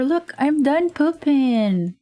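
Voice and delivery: put-on high, slow little kid voice; child voice. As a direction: drawl it out like a proud toddler.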